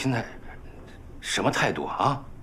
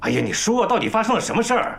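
high-pitched, impatient questioning; fed-up older brother; exasperated demand; 9 semitones higher than usual. Acted. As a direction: press him hard for an answer.

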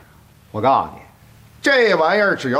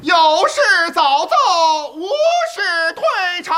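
palace eunuch; faster than usual. Acted. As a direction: call out the court dismissal like a eunuch. Court dismissal call in palace.

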